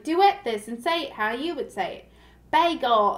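imitated Southern woman, lilting; Southern accent. Woman challenges viewers to duet her, imitated Southern accent. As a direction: put on a Southern accent to give instructions. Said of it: "really Southern".

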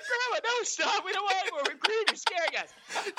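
high, loud mock-scared child pleading; scared kid. Kids begging drunk grandma to stop, high scared voice.

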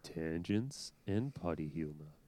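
low, slow, loud radio announcer voice. Shown as a demonstration. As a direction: drag out the show name like a DJ. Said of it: "Give me a line".

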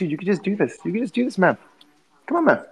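high, pleading urge; hammed-up high pleading to Joe Rogan; high mock coaxing. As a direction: coax him like an eager fan.

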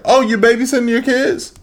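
high, sing-song fake surprise; mock nosy person. Mimicking people asking a mom about her kids, high fake surprise. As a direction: play the nosy acquaintance, voice up high. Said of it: "never heard anybody say to a mom".

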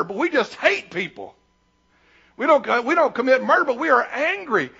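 ordinary talk, nothing marked

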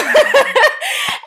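very high, loud laugh of joy; a real, tickled laugh; delighted laugh. Real, not acted. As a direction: laugh out in happy disbelief. Laughing after mom's quote.

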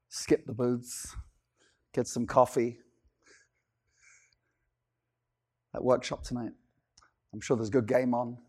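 low, soft, indifferent mumble; mocking bored churchgoer. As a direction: play the half-hearted pew sitter.

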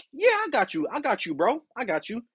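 high, eager yes-man voice; eager agreement. Retold or imitated. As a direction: play the overeager helpful worker. Cheerful reply to coworker.

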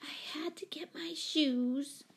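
put-on small, breathy child's whisper; barely whispered by a timid little girl. A narrator's reading. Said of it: "said Betsy in a small voice".

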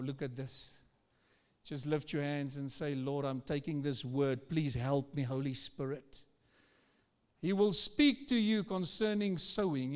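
usual speaking voice

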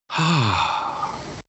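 long, breathed-out sigh of refusal; weary sigh. Retold or imitated. Reacting to The Marvels, with a long weary sigh. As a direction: let out a heavy acted sigh.

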